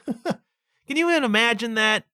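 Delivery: "Can you even imagine that?" chuckling in disbelief; laughing. Real, not acted.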